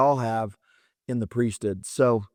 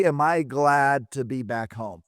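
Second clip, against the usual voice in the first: ordinary talk, nothing marked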